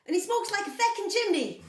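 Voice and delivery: cheeky Irish accent impression. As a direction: do the friend's Irish brogue, punchy. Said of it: "with the German accent is amazing".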